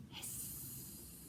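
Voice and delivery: long voiceless hiss; snake hiss. Pretend. Librarian imitates snake.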